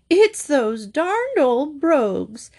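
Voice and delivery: high, slow, wailing mock sob; hammed-up tearful complaint; sobbed. A narrator's reading. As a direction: whine it out as if crying. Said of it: "she sobbed".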